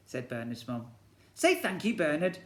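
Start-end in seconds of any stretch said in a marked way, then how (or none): 1.3-2.4 higher, louder prompting; prompting mum. Acted. Bernard's mum prompts him to say thanks, prompting. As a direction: nudge him to be polite.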